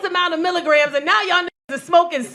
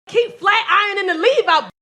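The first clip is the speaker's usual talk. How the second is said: ordinary talk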